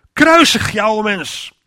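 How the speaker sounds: fervent sermon command, very slow; preaching exhortation. Real, not acted. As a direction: hammer each word home to the congregation. Preacher's exhortation to crucify sin.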